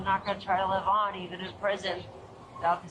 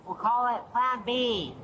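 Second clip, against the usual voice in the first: slow and clear; 4 semitones higher, slower than usual. Real, not acted. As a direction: say it slowly so they catch it. Repeats after not being heard.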